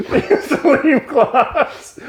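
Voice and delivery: high, slow words through laughter; laughing. Real, not acted. Laughing at the dumb plot. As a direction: squeeze it out while cracking up.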